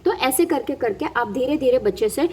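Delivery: ordinary talk, nothing marked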